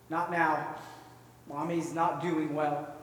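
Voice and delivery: low, slow, weary brush-off; mildly tearful mother. Acted. Crying mother turning away her little girl, subdued. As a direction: gently put the child off, low and slow. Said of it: "crying still".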